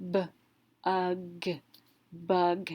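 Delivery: "b, ug, bug": onset, rime, then the whole word; sounded out, blended. Shown as a demonstration. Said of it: "So this word we would pronounce".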